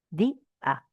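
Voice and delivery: "Di, a" in two separate syllables with a clear break; split into syllables. Shown as a demonstration. Teacher showing a student that the vowels separate, "Di, a" split in two. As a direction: say "Di, a" pulled apart at the hiatus. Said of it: "se separa".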